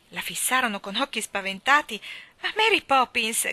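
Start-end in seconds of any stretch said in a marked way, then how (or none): 2.4-3.5 high, loud plea; crying out. A narrator's reading. Jane to Mary Poppins, crying out high and loud. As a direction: call out her name in alarm. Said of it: "gridò Jane".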